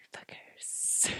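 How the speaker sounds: sudden hushed whisper. Real, not acted. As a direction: whisper it as a sign-off. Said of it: "Why did you whisper?".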